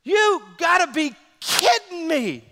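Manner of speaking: high, loud, slow disbelieving shout; retold yell at ref. Pastor shouting at the ref over a penalty, disbelief. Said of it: "I'm screaming at this ref".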